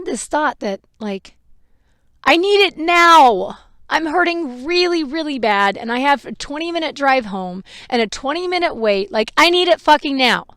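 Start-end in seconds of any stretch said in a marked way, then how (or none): 2.2-10.5 loud, high, insistent outburst; imagined desperate demand. Retold or imitated. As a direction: demand it as if in pain. Voicing the demand she held back.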